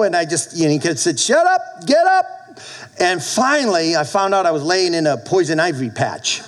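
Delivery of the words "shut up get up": commanding shout. Retold or imitated. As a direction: snap it out like a stern father. The Lord repeats the command.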